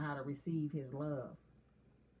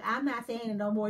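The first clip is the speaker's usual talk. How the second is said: higher, louder stubborn retort; mock refusal; 4 semitones higher, 5 dB louder than usual. Wife refusing her husband another apology, mock-stubborn. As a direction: playfully act out digging your heels in. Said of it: "And I be like".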